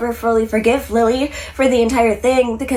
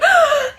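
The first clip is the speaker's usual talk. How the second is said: high startled gasp; crowd gasp. Retold or imitated. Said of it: "everyone around them is like".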